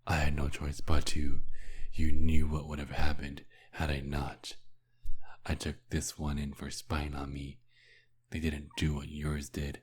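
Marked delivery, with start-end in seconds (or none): 0.1-4.5 loud angry retort. Acted. Vampire shouting at Nikolai to stop talking about her, loud and angry. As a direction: snap back hard, then shout it. Vampire defends past lover angrily.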